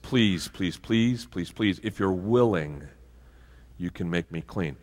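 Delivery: soft, pleading please, please, please; begging. Retold or imitated. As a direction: beg quietly for healing. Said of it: "He begged him".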